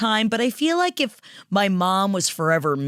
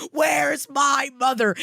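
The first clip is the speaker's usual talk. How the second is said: drawn-out mock wail, pitched up; Savannah Guthrie; 5 semitones higher, slower than usual. Cohost imitating a daughter seeking her missing mother, mock shouting. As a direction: call it out slowly, hammed-up. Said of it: "Savannah Guthrie's like".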